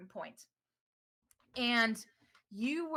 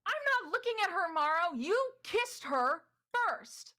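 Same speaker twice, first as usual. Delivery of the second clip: much higher and louder, slowing to a pointed accusation; Ystera snapping back; 11 semitones higher, 4 dB louder than usual. Acted. Ystera countering Morrow in the argument, high and sharp. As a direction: turn the blame back, drawn out. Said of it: "And then you hear".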